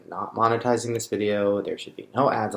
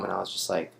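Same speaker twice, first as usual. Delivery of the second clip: ordinary talk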